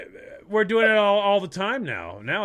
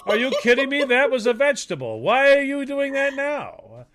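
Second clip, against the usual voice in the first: outraged Italian; 8 semitones higher, 5 dB louder than usual. Retold or imitated. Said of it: "They're like".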